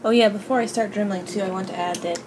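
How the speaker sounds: ordinary delivery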